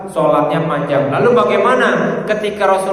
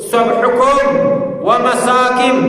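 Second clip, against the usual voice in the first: raised, rousing commander's cry, higher; warning army commander; 7 semitones higher than usual. Retold or imitated. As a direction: call it out like a general warning his troops. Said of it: "ka'annahu mundhirun jaisun yaqulu".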